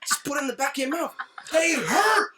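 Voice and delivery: loud high yelling; shouting. Retold or imitated. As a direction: yell it back in disbelief. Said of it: "I'm like".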